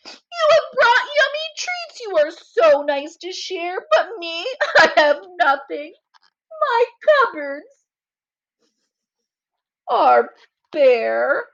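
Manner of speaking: high, tearful bear voice; moaning Bear. Acted. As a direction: whimper it out, feeling empty-handed. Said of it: "Bear mutters ... moans".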